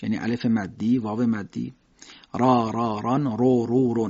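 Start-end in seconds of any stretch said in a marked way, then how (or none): 2.3-4.1 thick, dark r in را and رو; را said heavy, back and rounded. Shown as a demonstration. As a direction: drill ران and رون with a full-mouthed r.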